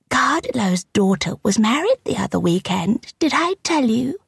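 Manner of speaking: high, small voice lifting at the end; tiny, coy little old woman; tiny kitteny voice. A narrator's reading. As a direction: play a kittenish old lady with gossip.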